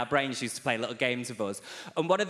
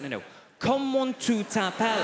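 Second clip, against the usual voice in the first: exasperated professor; 7 semitones higher than usual. Retold or imitated. French professor repeating his question to a student, exasperated and higher.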